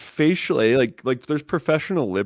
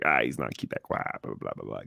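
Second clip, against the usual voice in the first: imitating locals; 9 semitones lower, 7 dB quieter than usual. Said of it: "Be like".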